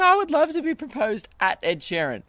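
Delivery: high-pitched mock-gushing fan voice; gushing girls. Imitating girls listening to the show, high gushing voice. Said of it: "girls listening to this going".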